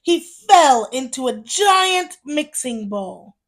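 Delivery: usual speaking voice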